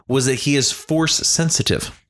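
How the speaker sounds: ordinary talk, nothing marked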